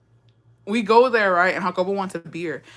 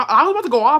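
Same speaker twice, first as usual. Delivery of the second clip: heated retelling; 7 semitones higher, 7 dB louder, faster than usual. Retold or imitated. Rising anger retelling.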